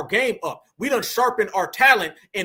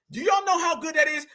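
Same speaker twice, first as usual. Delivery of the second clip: usual speaking voice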